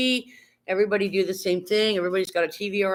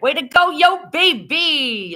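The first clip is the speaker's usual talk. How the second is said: excited cheer; 10 semitones higher, 8 dB louder, slower than usual. Real, not acted. Streamer cheering a viewer's sub.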